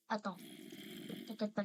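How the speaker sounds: normal talking voice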